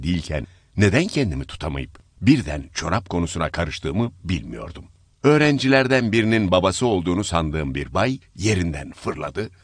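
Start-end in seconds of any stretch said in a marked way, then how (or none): none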